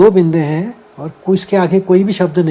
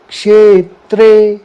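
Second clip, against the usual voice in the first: क्षेत्रे higher and loud, slow, hitting क्ष; क्षेत्रे with a strong, slow क्ष; stress on क्ष; 4 semitones higher, 7 dB louder, much slower than usual. Shown as a demonstration. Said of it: "आघात आएगा क का".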